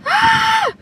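very high, loud scream. Real, not acted. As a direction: let out a piercing-high yell. Screams out after drinking.

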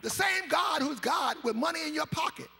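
loud, rising sermon build; loud sung-out sermon cadence; preaching at full force. Acted. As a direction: preach it hard to the congregation.

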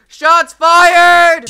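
shouted callout. Acted. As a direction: yell it to the crowd. Repeated, bigger callout.

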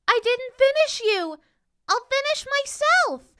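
high, loud little paper princess; thin, new voice. A narrator's reading. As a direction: call out in a thin, newborn voice. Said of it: "in a voice as thin and new".

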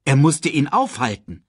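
plain reading voice

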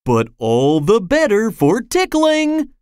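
high, gleeful lift; jolly tickler's voice; cheerful. A narrator's reading. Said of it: "said Mister Tickle cheerfully".

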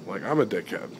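normal talking voice

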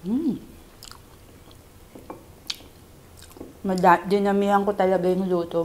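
chewing while talking; muffled eating speech; mouth full. Real, not acted. Seen 1: Food put into mouth at -1; closed, working mouth through the labelled words.

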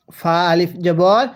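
ফা আলিফ জবর read slowly, with the fa held for one alif; slow one alif stretch. Shown as a demonstration. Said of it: "আমার সাথে পড়বেন".

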